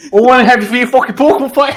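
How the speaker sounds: high, loud, fast mock-Scottish yell; hammed-up Scottish shouting; badly faked excitable Scottish brogue; bad Scottish accent. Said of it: "If only I could do an actual Scottish accent".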